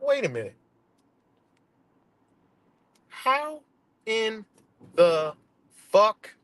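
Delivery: slow, high, drawn-out disbelief; incredulous. Retold or imitated. Vlogger replaying his reaction, high and drawn out. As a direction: stretch each word in shock. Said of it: "I'm like".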